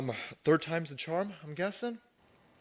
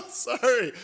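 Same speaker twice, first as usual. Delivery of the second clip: ordinary talk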